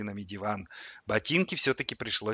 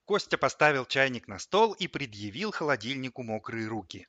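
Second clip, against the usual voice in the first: plain reading voice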